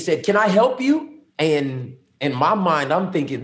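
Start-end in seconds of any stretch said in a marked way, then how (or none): 0.2-1.2 high questioning voice, rising at the end; imitated officer's challenge. Officer questioning man on his property, high rising challenge. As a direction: ask it high, lifting the last word.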